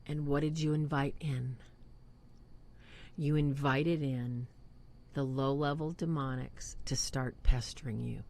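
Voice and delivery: ordinary talk, nothing marked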